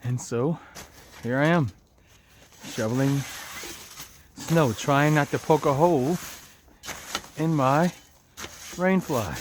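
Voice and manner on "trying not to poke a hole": ordinary talk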